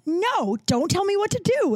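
high-pitched, mock-defiant retort; retold indignant refusal. Retelling a bar argument with a partner, indignant.

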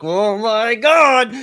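hammed-up online outrage, slow and loud; mock internet hysteria. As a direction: stretch it out like a tinfoil-hat panicker. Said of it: "where they were like".

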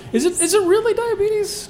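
very high-pitched, amused questioning; voice going up. Real, not acted. Host questioning the guest's scariest disease, voice shooting up high. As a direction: squeak it out with a laugh. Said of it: "Why did my voice go up?".

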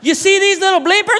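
high, loud Irish woman voice; hammed-up Irish lady pointing at the kids; drunk Irish lady accent. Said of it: "Drunk Irish woman in a pub".

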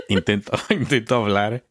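giggling through the words; laughing while talking. Real, not acted. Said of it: "la voz sale así media rara".

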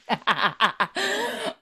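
real squealing laugh; high laugh. Real, not acted. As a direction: burst of high-pitched laughter. Reacting to joke.

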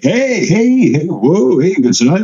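high, whooping fan hello; excited greeting. Retold or imitated. Passers-by greeting the comedian after his show, excited imitation. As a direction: imitate passing fans cheering him.

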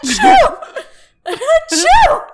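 loud, high mock sneeze; imitated sneeze. Coworker mimicking Barbara's loud sneeze on the walkie. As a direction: belt out a cartoon sneeze. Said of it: "we hear Barbara sneeze".

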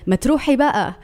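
put-on quoted line in Egyptian-accented Arabic. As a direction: imitate an Egyptian guy calling it out. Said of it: "you can hear an Egyptian accent".

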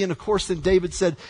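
ordinary talk, nothing marked

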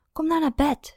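high whiny plea; pleading whine, stress on nou; complaining. A narrator's reading. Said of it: "zei Esther klagerig".

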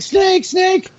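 high mock taunt of coworkers; squeaky teasing "snake, snake" sing-song. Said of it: "they'd be like".

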